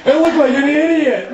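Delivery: high-pitched, hammed-up sarcastic performer; mock ceremony dancer. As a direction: pitch it way up, pretending to be thrilled. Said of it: "if you're native and you're out there dancing".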